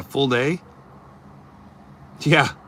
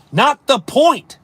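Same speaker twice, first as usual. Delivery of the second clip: slow, higher and louder, each word hit; firm rebuttal; 7 semitones higher, 4 dB louder, slower than usual. Acted. Streamer answering chat's objection, firm rebuttal. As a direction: shut down the objection flatly.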